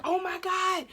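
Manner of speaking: high-pitched mock wail; retold tearful panic. Recalling her water breaking, high re-enacted cry. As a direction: act out crying in alarm. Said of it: "I was crying".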